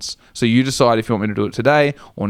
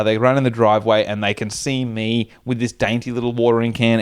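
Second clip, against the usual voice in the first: ordinary talk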